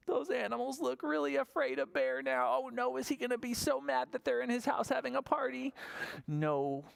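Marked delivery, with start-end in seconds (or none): none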